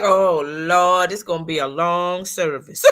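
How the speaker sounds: low, laughing groan of dread; hammed-up dread of a long service; groaning churchgoer. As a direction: sigh it like a bored churchgoer, laughing. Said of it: "And you be like".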